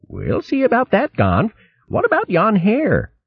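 put-on young otter bantering about the hare. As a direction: tease your friend. Otter Ferdy answers Gonf.

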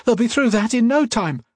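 high, alarmed warning; gasped urgency. A narrator's reading. Octavia warning as hounds batter the pillars, high gasped urgency. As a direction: warn them with pitch held high. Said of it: "Octavia gasped".

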